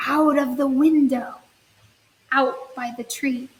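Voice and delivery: slow, sing-song storybook reading; slow children's story read-aloud. Acted. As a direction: read it gently to little kids.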